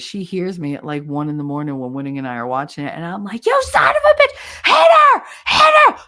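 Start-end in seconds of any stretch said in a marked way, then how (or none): 3.5-6.0 very high, very loud furious scream. Retold or imitated. At the TV during wrestling, furious screaming.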